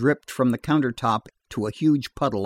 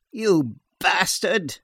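whispered curse; 4 semitones higher, slower than usual. A narrator's reading. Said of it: "whispered Missus Sloane".

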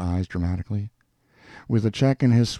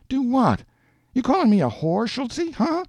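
very high, indignant woman's voice; affronted challenge. A narrator's reading. Woman confronts man over an implied insult, indignant. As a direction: fire it back, rising to a sharp "huh". Jeannie challenges him in a quarrel.

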